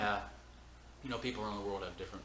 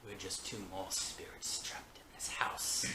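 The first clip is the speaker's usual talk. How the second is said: spooky whisper; 5 dB quieter, slower than usual. Pretend. Spooky joke about being ghosts.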